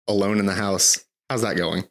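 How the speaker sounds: normal talking voice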